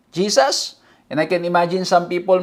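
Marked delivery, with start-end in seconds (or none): 0.1-0.8 tentative question, rising at the end; doubtful questioning. Retold or imitated. The lone shouter questioned by glaring people, doubtful echo. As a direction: ask it as if unsure, under hostile stares. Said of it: "Tas tinignan siya masama".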